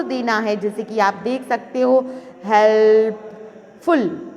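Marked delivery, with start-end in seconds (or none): 2.4-4.3 "helpful" drawn out in separate parts; slow syllable-by-syllable "helpful"; split into syllables. Shown as a demonstration. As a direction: break "helpful" slowly, pausing before फुल. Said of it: "कितने हिस्से में अलग-अलग करते हैं".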